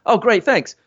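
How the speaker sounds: high, chirpy thanks; mock delight. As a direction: play the thrilled child getting permission. Prankster replies to mother's yes.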